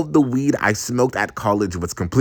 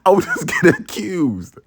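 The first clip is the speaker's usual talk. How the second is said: ordinary delivery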